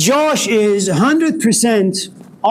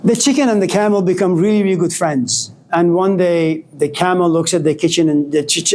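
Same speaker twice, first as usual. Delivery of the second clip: ordinary talk, nothing marked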